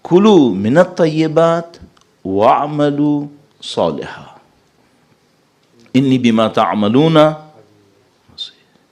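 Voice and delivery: slow chanted Arabic verse; slow Quran recitation voice; Quranic recitation. Real, not acted. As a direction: intone the verse slowly and melodically.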